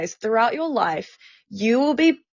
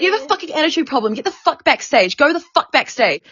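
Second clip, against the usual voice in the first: higher, rapid-fire imitation of a scolding; retold angry tirade; 7 semitones higher, faster than usual. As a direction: act out a furious manager ordering someone away. Retold manager orders her away.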